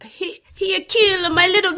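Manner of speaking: a clumsy mock Swedish accent on request; bad Swedish accent. Shown as a demonstration. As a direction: try a Swedish accent and miss badly. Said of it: "Not even close".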